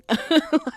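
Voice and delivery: a quick rising amused laugh; short real laugh. Real, not acted. To viewers who missed the point, short laugh. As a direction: let a real laugh escape.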